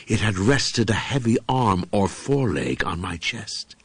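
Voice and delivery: usual speaking voice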